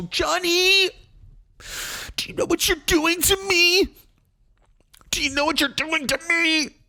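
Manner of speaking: very high, shrieky imitation of a distraught wife; mock hysterical wail. Wife confronting Johnny as he leaves, mock hysterical. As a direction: wail it in exaggerated falsetto.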